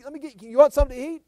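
high, eager host voice, hamming it up. Pretend. Abraham offering the visitors food, high and eager. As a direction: play Abraham as an over-keen host.

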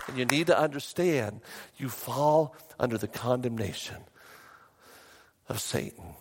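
ordinary talk

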